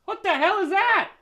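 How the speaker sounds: sudden high, loud outburst; shocked shout. Real, not acted. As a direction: blurt it out in disbelief. Surprise at a new set.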